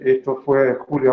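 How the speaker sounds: normal talking voice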